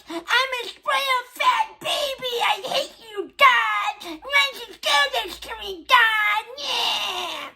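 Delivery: high wailing fake crying, hammed up; mock sobbing tantrum. Mocking the friend's breakdown at God with fake bawling. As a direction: bawl and wail like a spoiled baby.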